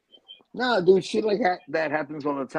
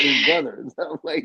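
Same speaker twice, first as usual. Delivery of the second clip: ordinary delivery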